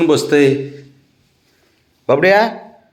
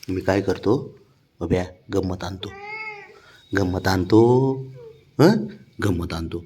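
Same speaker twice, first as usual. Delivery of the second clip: low, soft coaxing of a treat; hushed treat promise to a pet; 5 semitones lower, 6 dB quieter than usual. Real, not acted. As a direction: tempt the dog quietly.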